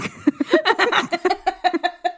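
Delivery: loud squealing laugh; high laugh. Real, not acted. Laughing at the quote.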